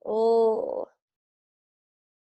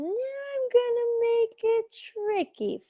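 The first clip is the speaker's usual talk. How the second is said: playful high; 11 semitones higher than usual. Acted. Teacher teases the class playfully.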